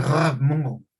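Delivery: "Ramón" with a throaty gh for the r; r of "Ramón" as a guttural gh; distorted r. Shown as a demonstration. Teacher demoing distortion: "Ramón" with guttural r. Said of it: "la R no está pronunciándose correctamente".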